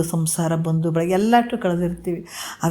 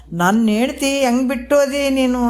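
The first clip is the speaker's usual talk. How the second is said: mild mock wail, pitched up; retold wailing; 6 semitones higher than usual. Husband overhears his wife mourning him, mildly imitated wailing. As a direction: imitate a wailing wife without really crying. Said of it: "ಅಂತ ಆಕೆ ಎಷ್ಟು ಗೋಳಾಡಕತ್ತಾಳ".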